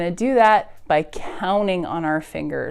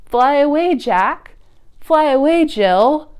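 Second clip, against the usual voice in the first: calling away; 5 semitones higher, 4 dB louder than usual. Acted.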